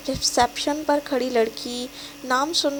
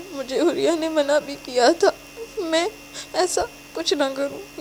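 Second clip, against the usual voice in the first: higher, tearful prayer; crying to God; 4 semitones higher than usual. Acted. Abandoned girl praying for help, crying. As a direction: pray through tears. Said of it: "وہ روتے ہوئے دل میں سوچتی ہے".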